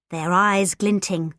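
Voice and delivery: plain reading voice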